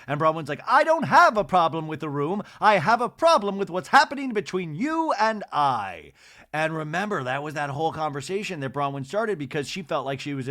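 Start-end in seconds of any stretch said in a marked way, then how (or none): 0.7-6.1 louder, higher mock indignation; indignant. Imitating Bronwyn's indignant retort to Lisa, raised. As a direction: protest louder, pitched up.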